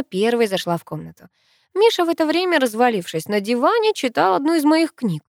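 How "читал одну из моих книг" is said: ordinary delivery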